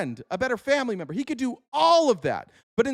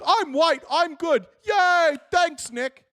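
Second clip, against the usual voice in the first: mock gullible fan; 8 semitones higher, much slower than usual. Fan thanking Nick, mocked with a giddy higher cheer. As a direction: squeal it like a happy simpleton. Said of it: "And you're like".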